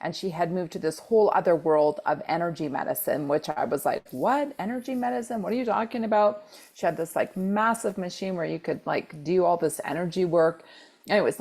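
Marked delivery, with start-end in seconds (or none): 4.1-6.4 high-pitched baffled disbelief; mock incredulity. Retelling her reaction to energy medicine, higher-pitched and incredulous. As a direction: re-enact being thrown, pitched up.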